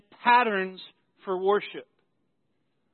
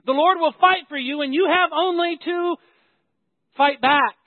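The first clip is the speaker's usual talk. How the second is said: louder, higher misquote, fight back hit hard; mock misquote; 6 semitones higher, 6 dB louder than usual. Shown as a demonstration. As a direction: fight back said with force and punch. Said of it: "Notice what Moses doesn't say here".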